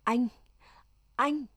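stunned, barely getting the word out; stammering shock. A narrator's reading. Loan finds her husband in the pit, stammering in shock. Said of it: "Loan ú ớ".

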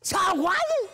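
high, loud frightened retort; scared outburst. Acted. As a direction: blurt out the fear at full voice. Thầy sợ, gắt lại. (thốt lên the thé vì sợ)

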